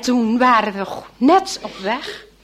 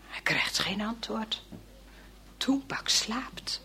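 whisper; 2 semitones lower, 14 dB quieter than usual. A narrator's reading. Whispered narration, boy sleeps.

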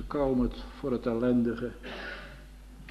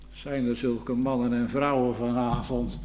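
normal talking voice

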